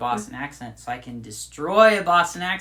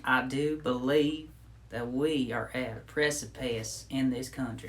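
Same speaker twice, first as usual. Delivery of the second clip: Lindsey Graham. Pretend. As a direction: deliver it like a drawling senator's speech. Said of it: "He sounded like Senator Lindsey Graham".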